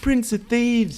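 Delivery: mock Liverpool narrator voice; Scouse accent. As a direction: ham up a thick Scouse accent. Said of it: "the strongest Liverpool accent".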